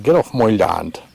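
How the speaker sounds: a light mock Cornish farmer growl; Cornish accent. Quoting someone telling him off, in mock Cornish accent. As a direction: toss it off in a jokey Cornish accent. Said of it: "In a Cornish accent".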